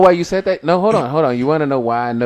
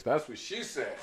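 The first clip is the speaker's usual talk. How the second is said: soft, sly mock punchline; smug punchline delivery; 3 semitones higher, 14 dB quieter than usual. Imitating mom leaning in with elbow, smug punchline delivery. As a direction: slip it in quietly, pleased with the joke. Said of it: "like she said something hilarious".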